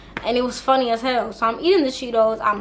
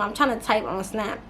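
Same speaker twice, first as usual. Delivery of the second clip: ordinary talk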